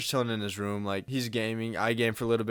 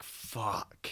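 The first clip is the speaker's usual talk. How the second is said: soft, voiceless curse under the breath; deflated curse; 8 dB quieter than usual. Retold or imitated. Teen learning mom will collect him, quiet dismay. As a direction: let it out quietly in retold dismay.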